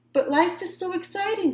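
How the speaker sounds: put-on high, eager kid voice; excited. A narrator's reading. Shark protesting to his teacher, excited. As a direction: bubble over with joy. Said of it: "said Clark".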